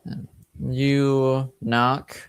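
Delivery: usual speaking voice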